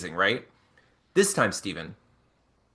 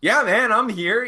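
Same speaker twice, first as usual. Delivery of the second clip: upbeat boss; 8 semitones higher, 5 dB louder than usual. Retold or imitated. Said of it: "he's like".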